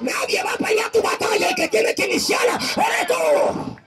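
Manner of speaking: high, driving exhortation; rally the congregation to fight; fervent preaching. Acted.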